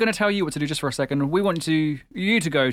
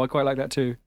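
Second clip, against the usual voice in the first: usual speaking voice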